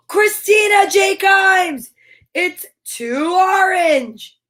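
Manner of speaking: gasping, slow, high excited shout. Real, not acted. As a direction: cry out the winner's name. Winner reveal with gasp.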